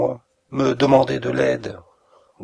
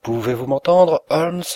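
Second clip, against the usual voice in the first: loud and higher, pressing for an answer; insistent call; 5 semitones higher, 7 dB louder than usual. A narrator's reading. Visitor rousing the sick Holmes, loud and insistent. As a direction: call him as if to wake him. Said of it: "du ton insistant de qui réveille un homme endormi".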